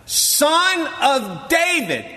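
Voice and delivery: high, slow desperate cry. Acted. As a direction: plead out loud over the crowd. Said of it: "he cried out even more loudly".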